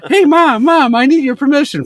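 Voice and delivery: put-on high, loud excited child; excited kid voice. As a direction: beg like an eager kid. Kid asking mom about dog.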